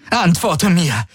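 high, agitated demand; calm gone. A narrator's reading. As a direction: demand an answer, all composure lost. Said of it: "Alle Ruhe war vergessen". (aufgebracht, hoch, fordernd)

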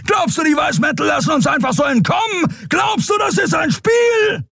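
high, strained shouting rage, building; enraged bellow climbing in pitch; furious roaring. Acted. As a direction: roar it at full fury, stretching the key words. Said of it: "Kerus Brüllen brachte sie zum Schweigen".

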